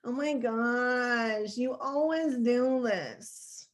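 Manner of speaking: slow, drawn-out whine; whiny voice. Shown as a demonstration. Coach demonstrating the brain bully's nagging, whiny voice. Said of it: "Would it be a whiny".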